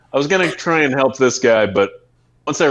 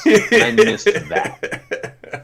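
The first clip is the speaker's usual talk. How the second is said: laughing; 10 semitones higher, much slower than usual. Real, not acted. Laughing at own missed swing.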